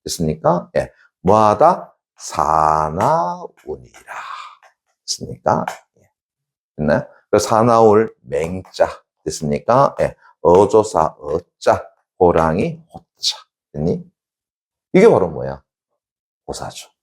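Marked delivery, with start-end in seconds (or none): 0.0-4.1 very slow, drawn out; slow recitation. Shown as a demonstration. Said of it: "teacher drills the saying". 7.3-13.4 slow, careful character reading; slow explaining. Shown as a demonstration. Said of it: "explaining characters slowly".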